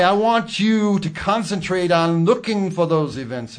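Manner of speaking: high preacher voice; preaching. Acted. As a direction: preach it to your flock. Mock preacher voice.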